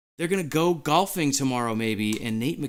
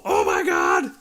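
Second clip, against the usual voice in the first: loud thrilled cry of disbelief; excited shout; 12 semitones lower, 4 dB louder, slower than usual. Real, not acted. Fan yelling to chat as the Kraken advance, thrilled shout. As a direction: shout it, overwhelmed with joy. Fan reacting to playoff win.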